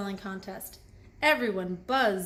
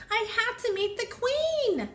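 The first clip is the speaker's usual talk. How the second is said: high, urgent little bee voice; distressed high bee voice; upset cry; 13 semitones higher than usual. A narrator's reading. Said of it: "Lizzie cried".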